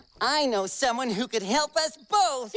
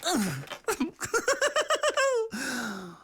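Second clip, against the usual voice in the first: long giggle trailing off; high-pitched run of laughter; giggling; 4 semitones higher than usual. Acted. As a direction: giggle until out of breath. Giggling after flopping on bed.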